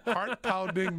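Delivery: giggling through the word; laughing. Real, not acted. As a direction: laugh it out, amused at yourself. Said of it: "to me, was heart-pounding".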